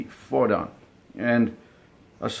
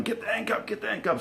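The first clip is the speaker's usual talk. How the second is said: mock shout; 12 semitones higher, 5 dB quieter, faster than usual. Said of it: "my dad was shouting".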